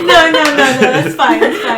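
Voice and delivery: laughing reassurance, slowed; no-no through giggles. Real, not acted. Reassuring friend amid laughter.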